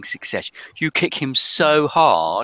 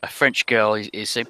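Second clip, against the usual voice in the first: ordinary delivery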